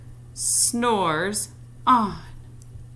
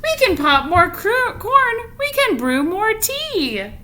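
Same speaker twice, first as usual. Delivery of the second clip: much higher, louder mouse squeak; a bright little mouse piping up; squeaky mouse; 14 semitones higher, 6 dB louder, slower than usual. Acted. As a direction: cheer up the bear in a tiny squeaky voice. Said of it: "Mouse squeaks".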